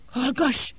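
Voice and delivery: sharp, high hissed command; hissed hush. Acted. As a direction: hiss her into silence.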